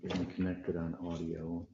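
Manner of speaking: very soft, low murmur; quiet aside. Real, not acted. Judge to self between cases, in a very quiet murmur. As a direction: talk under your breath.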